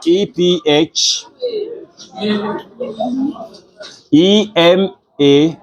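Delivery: name each letter slowly for the board; spelling letter by letter. Shown as a demonstration. Teacher spelling a word aloud to the class, letter by letter. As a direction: spell "ephemeral" as slow, separate letters. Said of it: "am I correct".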